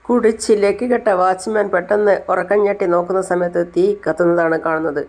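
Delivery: plain reading voice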